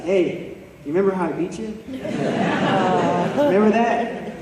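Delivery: gloating, laughing through the taunt; teasing laugh. Retold or imitated. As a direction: rub the old win in his face with a chuckle. Friend teasing him about the win.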